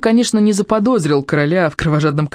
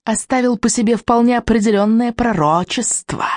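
purring witch; 4 semitones higher than usual. A narrator's reading. Witch telling Vikings of the prophecy, purring. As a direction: purr it theatrically, stretching key words. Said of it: "промурлыкала ведьма".

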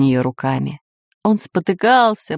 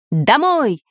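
higher-pitched, resolute order; decisive command; 7 semitones higher than usual. A narrator's reading. Samodelkin orders the flight home, decisive command. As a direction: give the order firmly. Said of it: "решительно скомандовал Самоделкин".

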